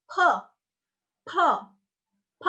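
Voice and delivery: P released with a puff of air; aspirated P. Shown as a demonstration. Teacher showing ada aspirasi, puffed P. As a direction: breathe out hard on each short P. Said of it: "Ada aspirasi yang tadi aku bilang".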